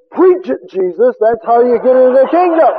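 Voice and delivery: high, loud eager cheer; cheering amen. Acted. Poor man cheers Jesus' teaching, high and loud. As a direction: cheer him on like a revival crowd.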